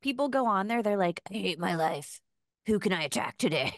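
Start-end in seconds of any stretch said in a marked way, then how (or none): 1.3-3.7 low, sullen Twitter-troll voice; mock internet grump. Imitating miserable people on Twitter picking a fight, mock grump. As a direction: put on a miserable online attacker, pitched down. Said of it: "In that voice. That's how they sound".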